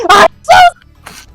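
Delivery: very high, laughing, shouted wail; mock histrionic apology. Roleplayer's over-the-top apology to upset users, shrieked high. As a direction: shriek a fake teary apology through laughter. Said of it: "He's just like".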